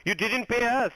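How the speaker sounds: usual speaking voice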